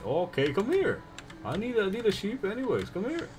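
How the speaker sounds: high, sing-song call to an animal; coaxing. Real, not acted. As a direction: call the sheep sweetly, high. Coaxing the sheep.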